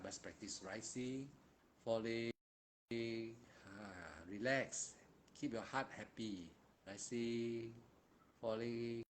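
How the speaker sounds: soft, slow guiding of rising and falling; guided meditation. Acted. Monk guiding students through meditation, soft and slow. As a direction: ease them into the breath quietly.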